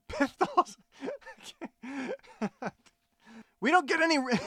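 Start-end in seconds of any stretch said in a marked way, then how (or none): 0.0-2.7 laughing. Real, not acted. Actor cracks up mid-line.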